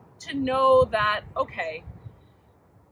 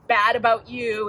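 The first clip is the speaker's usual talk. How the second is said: normal talking voice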